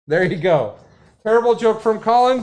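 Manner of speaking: ordinary talk, nothing marked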